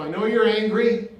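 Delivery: rising to a loud declaration; emphatic. Shown as a demonstration. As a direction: proclaim it to the crowd. Preacher repeats phrase louder.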